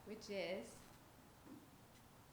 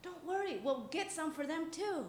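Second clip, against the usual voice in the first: put-on eager boy's voice; 8 semitones higher than usual. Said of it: "He said".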